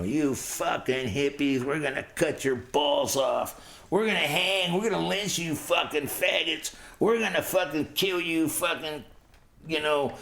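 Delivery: high, strained mock yelling; cursing and threatening. Men in a car hunting hitchhikers hidden in weeds, high threatening mock shouts. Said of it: "cursing and threatening us".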